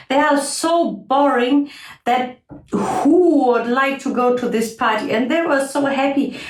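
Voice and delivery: grumpy midge with exaggerated boredom; mean, scornful midge. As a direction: sneer at it all as a bored midge.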